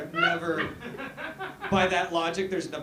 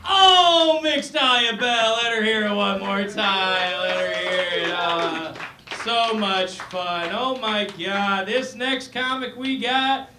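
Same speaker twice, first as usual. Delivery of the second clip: club MC announcer; 2 semitones higher, 7 dB louder than usual. Acted. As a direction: pump up the crowd for the next act. MC hyping up next comic.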